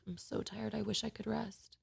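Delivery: soft, weary inner voice; quiet, drained wish for rest; tired thought. Retold or imitated. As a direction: murmur it like a thought you barely notice. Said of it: "you hear this thought go off in your mind".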